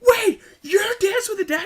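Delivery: high, loud yell of disbelief, laughing; shocked shout. Retold or imitated. Shocked fan realising who the driver is. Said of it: "And I'm like".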